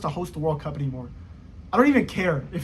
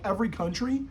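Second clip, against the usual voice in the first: ordinary talk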